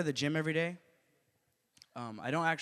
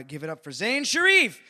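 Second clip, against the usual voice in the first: loud announcer build to the name; rising MC call, big finish; stage introduction; 6 semitones higher, 12 dB louder than usual. Real, not acted. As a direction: shout the comic's name to the crowd. Host introduces next comic.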